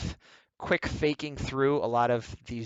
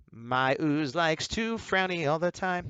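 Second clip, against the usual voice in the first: slow, higher put-on villain voice; 4 semitones higher, slower than usual. Artist voicing the grumpy villain, slow higher voice. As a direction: play the ooze villain, drawn out.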